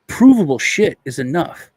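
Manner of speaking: emphatic insistence. Real, not acted. Insisting point to host.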